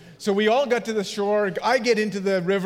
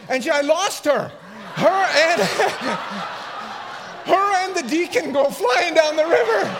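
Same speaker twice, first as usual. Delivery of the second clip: laughing; 8 semitones higher, 4 dB louder than usual. Real, not acted. Pastor laughing at his own story.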